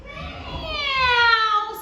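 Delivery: very high, loud drawn-out meow; cat meow. Acted. Cats on the garden wall, long high meow. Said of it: "sang the cats on the wall".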